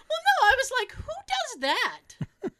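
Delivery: half-laughing, who would do that; laughing disbelief. Retold or imitated. Telling her friend, laughing in disbelief.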